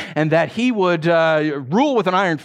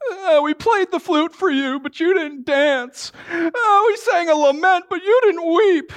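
put-on high, singsong childish taunt; mock the kids calling out in the marketplace; whiny children; 13 semitones higher than usual. Preacher voicing sulky children, high and singsong.

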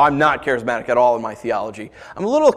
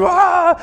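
1 mock cry; 4 dB louder than usual. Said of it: "and they're like".

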